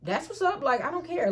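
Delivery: unbothered. Retold or imitated. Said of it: "I guess he thought I was gonna".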